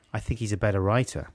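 ordinary talk, nothing marked

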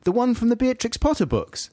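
high-pitched put-on girlfriend voice; puzzled girlfriend's question. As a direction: ask it high, in mock puzzlement. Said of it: "she asked me".

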